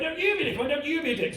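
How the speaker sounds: high-pitched swoop on "you"; bickering, stress on YOU. Acted. Back-and-forth row with dad.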